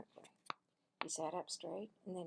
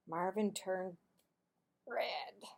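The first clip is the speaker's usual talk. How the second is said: plain reading voice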